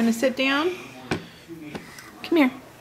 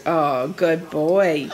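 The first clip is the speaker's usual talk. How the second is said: tender praise; 9 semitones lower, slower than usual. Real, not acted.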